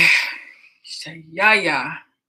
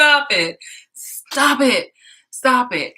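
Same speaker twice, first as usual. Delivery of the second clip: louder, repeated exasperated stop it; fed-up pleading with the screen; 6 semitones higher, 7 dB louder, much slower than usual. Real, not acted.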